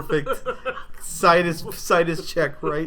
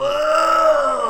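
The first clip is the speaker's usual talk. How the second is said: excited; 8 dB louder, slower than usual. Real, not acted. Excited about King Kong vs Godzilla.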